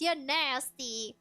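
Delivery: ordinary delivery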